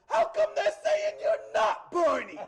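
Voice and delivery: high, shouted Boston indignation; deep Boston accent. Retold or imitated. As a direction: shout it like an outraged Boston kid. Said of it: "Deep Boston accent".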